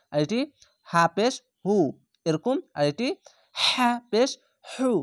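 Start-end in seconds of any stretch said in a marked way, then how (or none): 3.6-4.9 হু pressed from the throat, higher; throaty h sound. Shown as a demonstration. Teacher to students, throaty হু. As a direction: squeeze হু from the throat. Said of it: "গলা এরকম হবে".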